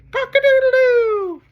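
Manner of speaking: loud, very high crowing; rooster crow. Acted. Reader imitating a rooster for children, loud high crow. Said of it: "He can sound like a rooster".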